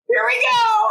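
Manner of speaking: very high, loud squeal; hammed-up nervous excitement; giddy dread. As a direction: squeal it through rising giggles. Acts out bracing to say it.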